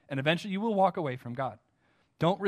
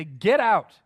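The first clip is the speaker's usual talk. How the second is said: a sharp, louder mock shout; retold shout; 2 semitones higher, 7 dB louder than usual. Friend refusing the gospel, louder mock shout. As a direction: bark it like an angry friend. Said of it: "they shout at you".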